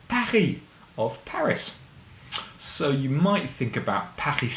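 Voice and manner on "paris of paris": excited reveal. Acted. Reveal after whisper.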